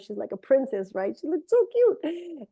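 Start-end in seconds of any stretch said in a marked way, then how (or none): 1.2-2.5 put-on higher, slower doting baby-talk voice; cooing over a toddler. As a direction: coo it like to a sweet little girl. Cooing over cute little daughter.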